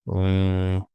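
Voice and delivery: usual speaking voice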